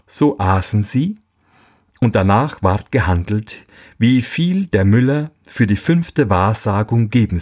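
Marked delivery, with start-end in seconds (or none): none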